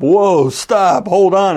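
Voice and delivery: mild mock panic; panicked calls. A narrator's reading. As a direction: hurry the horse to stop before the cliff. Rider panics near the cliff.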